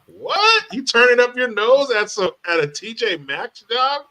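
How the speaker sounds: shrill high what, incredulous; mock outrage, voice jumping high; mock disbelief. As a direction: act shocked he turned it down. Mocking disbelief at applicant.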